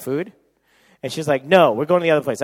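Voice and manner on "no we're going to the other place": loud, firm refusal; retold argument. Girlfriend insists on another restaurant, argumentative. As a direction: refuse loudly, as in a retold spat. Retold quarrel over where to go.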